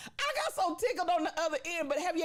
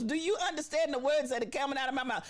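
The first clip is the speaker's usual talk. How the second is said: normal talking voice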